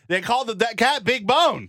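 high, loud and excited. Real, not acted. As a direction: burst out in delight. Excited about cat show names.

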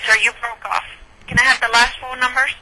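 ordinary talk

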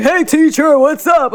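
loud, high, hearty greeting; big-voiced grown men. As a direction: boom it out like grown former students. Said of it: "grown men with big voices".

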